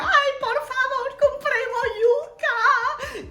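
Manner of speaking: very high, slow, half-crying whine; milk the plea with a laughing sob; hammed-up child whining for cassava; over-the-top begging. Kids begging for fruit.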